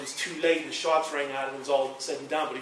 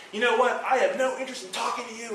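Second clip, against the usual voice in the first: imitating an angry refusal, higher and louder; angry retort; 8 semitones higher, 4 dB louder than usual. Agent hanging up on the caller, retold as an angry refusal. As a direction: snap back like an offended agent. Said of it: "I can't convey the anger".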